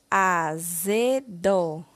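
AZEDO drawn out slowly as a, ze, do; syllable by syllable. Shown as a demonstration. Teacher forming a word from ordered syllables, slowly syllable by syllable. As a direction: sound out AZEDO one syllable at a time. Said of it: "ordene as sílabas".

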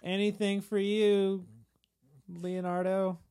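high, loud, slow eager offer; fawning thieves. Retold or imitated. Thieves on the cross fawning to Leonardo. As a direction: gush it to please.

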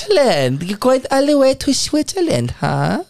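high, put-on German accent. Cohost mocks the German publisher in a German accent. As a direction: tease in a hammy German accent. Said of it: "Is that a better German accent?".

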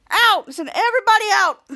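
loud high shout to clear the room; shouted order. Retold or imitated. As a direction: bark them all out of the house. Henry orders family out.

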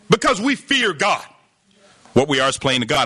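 ordinary talk

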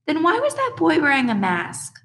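put-on very high little-kid voice, rising; small child's question. A narrator's reading. Little brother asking his babysitter, high child voice.

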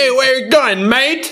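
mock Australian accent. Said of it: "ben Amerikan aksanıyla kurabiliyorum".

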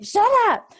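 high, loud retort; mock exasperated yell. Mom answers bratty kids, high loud mock yell. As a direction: imitate a fed-up mom yelling.

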